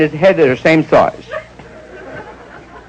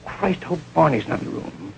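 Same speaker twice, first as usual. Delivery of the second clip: dreading aside; 12 dB quieter than usual. Retold or imitated. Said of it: "I went".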